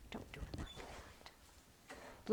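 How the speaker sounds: slow whispered warning; conspiratorial whisper. Real, not acted. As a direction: hush it down to a secret.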